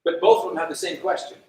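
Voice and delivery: ordinary delivery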